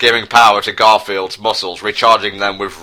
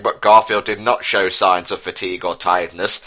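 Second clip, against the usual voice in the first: ordinary talk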